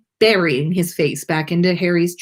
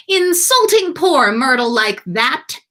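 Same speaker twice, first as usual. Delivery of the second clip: mocking poltergeist; 6 semitones higher, 5 dB louder than usual. A narrator's reading. Peeves scolding from above.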